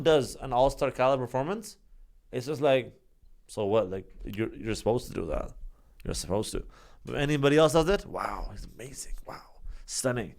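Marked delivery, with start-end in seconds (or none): 3.5-6.6 low, soft, unimpressed shrug; mock indifference. Voicing indifference to star's performance. 8.1-10.3 hushed, soft-voiced admiration; mock awe. Voicing awed fans of lesser player.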